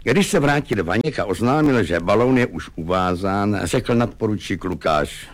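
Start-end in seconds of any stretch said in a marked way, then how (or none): none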